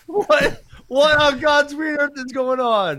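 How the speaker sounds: high, loud mock bewildered shout; imitating the hitman. Retelling the hitman in South Carolina, mock bewildered shout. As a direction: play the baffled hitman, hammed-up.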